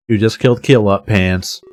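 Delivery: ordinary delivery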